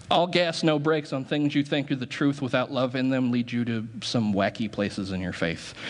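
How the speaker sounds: ordinary talk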